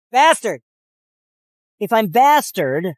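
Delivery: ordinary talk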